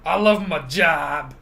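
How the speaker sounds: mocking an underpaid worker who loves her job; sarcastic mimic. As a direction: drag out love my job mockingly.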